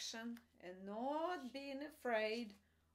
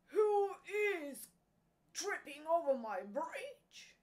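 loud troll demand, raised in pitch; troll challenge; 6 semitones higher, 7 dB louder than usual. A narrator's reading. Troll challenging the youngest goat, loud and demanding. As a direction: boom it out as a troll guarding his bridge. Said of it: "And the troll said".

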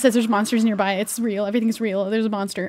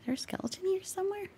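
ordinary talk, nothing marked

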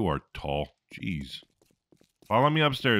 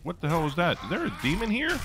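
higher, alarmed yelps; startled; 10 semitones higher than usual. Real, not acted. Player startled by an unseen demon, alarmed higher yelps. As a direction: jump at the shock and blurt it out. Sudden attack in game.

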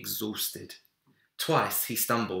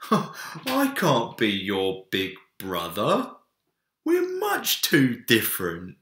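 amused bear; 3 semitones higher, 6 dB louder, slower than usual. A narrator's reading. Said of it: "Big Bear laughed".

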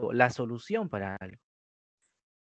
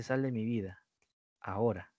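softer, lower, cold and final; quiet dismissal; 4 semitones lower, 9 dB quieter than usual. Shown as a demonstration. Teacher repeating the dismissal line, hushed and cold. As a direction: drop the voice to a hushed brush-off. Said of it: "Si te dice".

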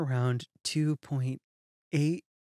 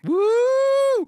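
high whooping woo; delighted whoop; excited cheer; 7 dB louder than usual. Real, not acted. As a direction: cheer like hearing of a windfall. Cheering at the income.

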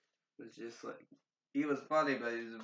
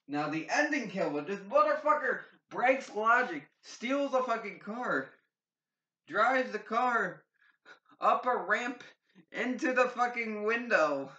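much higher and louder, hyped up; excited rant; 8 semitones higher, 6 dB louder than usual. Real, not acted. Reviewer hyping the ending kill to viewers, excited and loud. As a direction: gush about the wild car kill.

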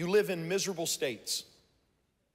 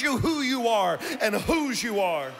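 sermon climax; 3 semitones higher than usual. Real, not acted. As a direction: exhort the congregation at full force. Preaching climax over music.

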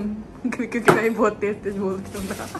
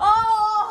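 ouch; 11 semitones higher, 5 dB louder than usual. Real, not acted. Stubbed little finger, cries out.

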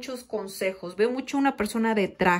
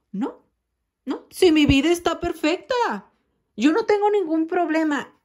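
higher, louder mock insistence that all is fine; defensive denial; 6 semitones higher, 4 dB louder than usual. Person asked what's wrong, mock-insisting life is perfect. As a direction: brush off the question with forced brightness. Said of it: "esta es una persona así".

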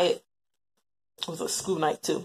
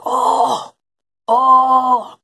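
loud, high crying oh; imitated cry; 6 semitones higher, 12 dB louder than usual. Mother imitating her son's distinct cry when hurt. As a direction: wail it out, imitating his cry. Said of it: "this distinct cry".

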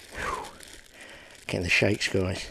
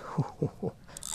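soft, higher, airy hoot of laughter; delighted chuckle; 6 semitones higher, 5 dB quieter than usual. Real, not acted. Angler landing a big common, delighted laugh. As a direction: chuckle with relief at the catch.

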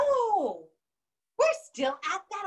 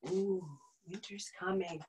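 soft, low ominous hush; spooky hush; 9 semitones lower, 12 dB quieter than usual. Acted. Teacher hints winter is coming, hushed and ominous. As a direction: tease them with a hushed warning.

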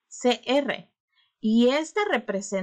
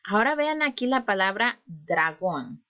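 ordinary talk, nothing marked

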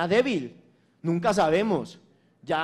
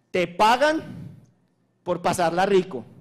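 excited; 5 dB louder than usual. Real, not acted. Excited boast about the job.

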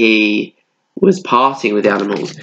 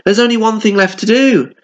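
the hare; 10 semitones higher than usual. A narrator's reading. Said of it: "The hare cried".

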